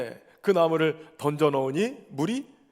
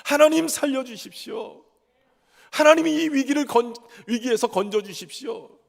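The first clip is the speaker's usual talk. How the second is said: high, urgent plea shouted up to God; acted desperate prayer, voice raised high; crying out in prayer; 6 semitones higher, 3 dB louder than usual. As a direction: cry out loudly for rescue, pitched well up. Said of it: "울부짖으면서 크게 외쳤다는 거예요".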